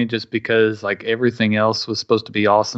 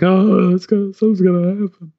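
mock spooked; 9 semitones higher, 5 dB louder than usual. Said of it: "I was like".

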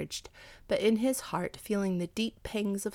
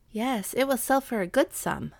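ordinary delivery

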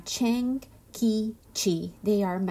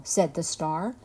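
ordinary delivery, nothing marked

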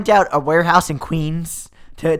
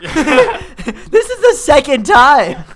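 slow, high, loud laughing protest; mock outrage; 10 semitones higher, 8 dB louder, much slower than usual. Jilted hero complaining of witches, mock-aggrieved through laughter. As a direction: drag it out in hammed-up exasperation. Acted lament, hammed up.

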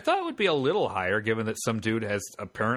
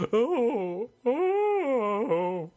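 bunyip call; 10 semitones higher than usual. Retold or imitated.

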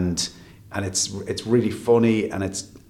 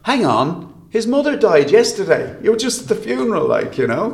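incredulous; 9 semitones higher, 5 dB louder than usual. Retold or imitated. Mocking viewer's thought.

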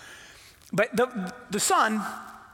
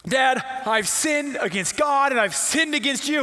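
prepared speech; 3 semitones higher, 3 dB louder than usual. Acted. Son finally facing his father, declaring his apology. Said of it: "And so he gets ready".

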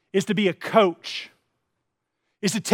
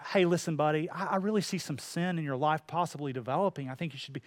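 much softer, careful friendly tone; gentle correction; 4 semitones lower, 9 dB quieter than usual. Retold or imitated. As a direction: tiptoe into a hard word kindly. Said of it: "somebody in the church and they go".

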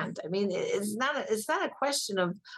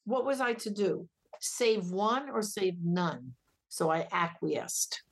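ordinary talk

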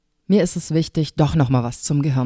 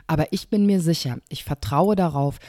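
ordinary talk

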